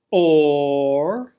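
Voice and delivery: long, loud OR; drawn-out OR. Shown as a demonstration. Teacher joining two inequalities, loud drawn-out OR. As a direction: stretch out OR loudly, swooping up at the end.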